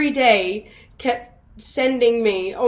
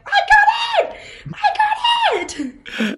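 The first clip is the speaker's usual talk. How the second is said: very high, drawn-out squealed shout; retold scream; 3 dB louder, much slower than usual. Actor home alone after winning the role, screaming with joy. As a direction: re-enact a screaming celebration, laughing through it. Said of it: "I just screamed for a minute straight".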